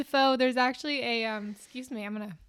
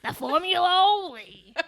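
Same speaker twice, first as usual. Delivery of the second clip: mock villain echo; 5 semitones higher, 3 dB louder than usual. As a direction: imitate the villain line with amusement. Echoing friend's Dafoe impression.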